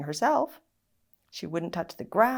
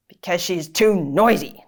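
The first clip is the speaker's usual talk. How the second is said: snapped; 3 semitones lower, 7 dB louder than usual. A narrator's reading. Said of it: "Dad snapped".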